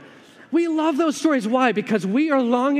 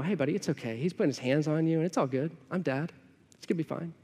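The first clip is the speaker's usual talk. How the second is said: soft, lulling reassurance, much quieter; mock soothing dad; 8 dB quieter, faster than usual. Father pretending to soothe his scared toddler, soft mock comfort. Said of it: "go to Eli and go".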